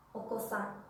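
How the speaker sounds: "okosan" with a clearly sounded second O; okosan con o clara. Shown as a demonstration. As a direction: say "okosan" in three beats, o-ko-san. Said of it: "¿Notan la diferencia?". ("okosan" con la segunda o bien pronunciada)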